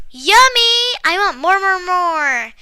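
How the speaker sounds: put-on hungry boy crying out for muffins; excited cry. A narrator's reading. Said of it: "cried Jack".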